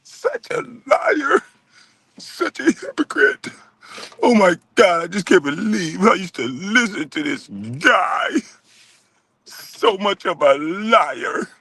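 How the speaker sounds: drawn-out, weepy mock whine; hammed-up feminine sobbing complaint; mock-feminine impression. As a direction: imitate a hurt man in a slow, girlish voice.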